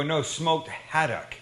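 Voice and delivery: slow, deliberate no, smoked haddock; slow correction. Retold or imitated. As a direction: spell it out for the confused clerk. Correcting Neil slowly.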